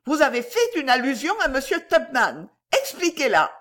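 high-pitched, insistent lady; forceful demand. A narrator's reading. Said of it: "dit avec force la tante demoiselle". (exigence aiguë et énergique)

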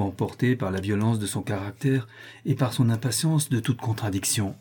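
ordinary delivery

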